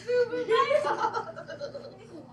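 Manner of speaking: stretched-out name through real laughter; laughing. Real, not acted. Teacher laughing at the silly name in class. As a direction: crack up while saying the name.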